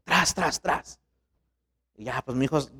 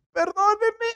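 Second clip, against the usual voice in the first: put-on little girl's apology; slower than usual. Crying girl apologizes.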